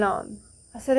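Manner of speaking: ordinary talk, nothing marked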